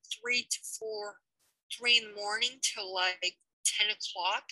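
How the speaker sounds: ordinary talk, nothing marked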